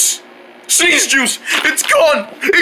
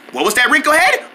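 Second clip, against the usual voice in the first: cartoon mom; faster than usual. Mom questions her son.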